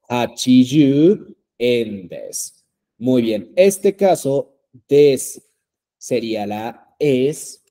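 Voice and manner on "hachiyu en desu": "Hachiyu en desu" said slowly, syllable by syllable; slow model reading. Shown as a demonstration. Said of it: "Es correcto". (pronunciación lenta y clara de "Hachiyu en desu")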